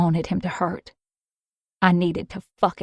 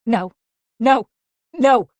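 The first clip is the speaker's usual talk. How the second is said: rising to a loud high panic; panicked no; 5 semitones higher, 6 dB louder, slower than usual. Acted. Mia panicking at the hidden syringe. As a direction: panic as you see the syringe. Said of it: "I panicked".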